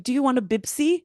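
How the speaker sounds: a lightly voiced Lebanese grandma, "Bibsi" with b's; mock grandma with "Bibsi" for Pepsi; grandma's Lebanese accent. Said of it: "She'll be like".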